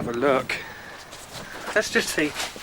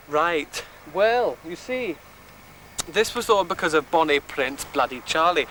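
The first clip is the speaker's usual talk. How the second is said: hammed-up Scottish accent, tour guide bluster; lightly done Scottish accent; mock Scottish accent. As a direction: keep the jokey Scottish guide going. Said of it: "Why are you speaking in a Scottish accent?".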